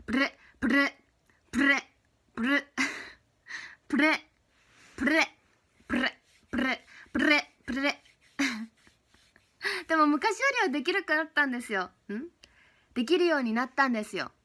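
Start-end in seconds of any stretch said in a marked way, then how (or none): 0.0-8.0 プレ with a rolled r on the r. Shown as a demonstration. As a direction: trill the r in プレ over and over. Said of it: "プレゼントだけでもたまにできん".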